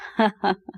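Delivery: brief amused laugh; short chuckle. Acted. Man amused by a little girl's fear, chuckling. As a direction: chuckle fondly at a child.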